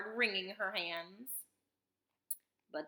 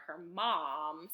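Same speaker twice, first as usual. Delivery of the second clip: ordinary delivery, nothing marked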